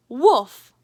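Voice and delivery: very loud playful bark; big mock bark; loud fake woof. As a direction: bark to fool them. Martha fakes a bark.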